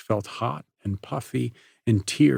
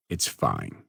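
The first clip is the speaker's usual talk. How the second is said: much lower, softer, resigned; 12 semitones lower, 5 dB quieter than usual. Acted. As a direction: give in quietly. Parker resigned.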